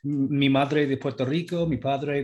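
retold explanation in slow-paced Spanish; Spanish-accented self-quote; Spanish pronunciation. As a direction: switch into Spanish, as if explaining to students. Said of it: "I'd say it in Spanish".

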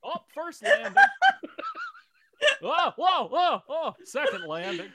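high, flustered, laughing star voice; Jimmy Stewart. Pretend. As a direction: narrate the tumble like a dazed film hero. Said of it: "Jimmy Stewart falling downstairs".

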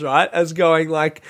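usual speaking voice

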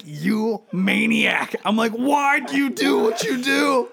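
hurt father crying out at his son; pained yell. Retold or imitated. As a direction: wail it through the pain. Yelling at son after punch.

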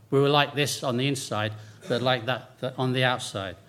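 usual speaking voice

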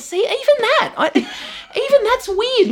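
very high, cracking with giggles; squeaky embarrassment. Retold or imitated. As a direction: squeak at your own politeness. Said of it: "And I went".

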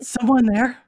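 dog's loud halting question; dog in broken English. Acted. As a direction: call out like a big simple dog. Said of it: "sounds to you like broken English because it's a dog".

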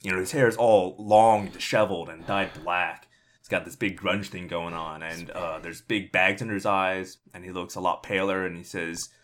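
ordinary delivery

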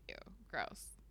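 quiet unvoiced whisper of disgust. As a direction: breathe it out in disgust. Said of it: "she's just like".

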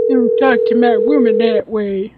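slow hillbilly drawl. Retold or imitated. Mocking the redneck just called.

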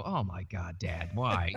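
low mock groan at Dad; exasperated kids. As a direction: imitate kids mildly fed up with Dad's joke. Kids groaning at dad's joke.

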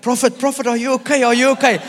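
high, urgent fussing of onlookers; mock concern. Worried bystanders voiced in story.

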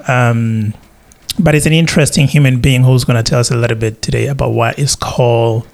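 normal talking voice

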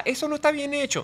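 a parody of the nagging critics; mock critic. Voicing critics' disapproval.

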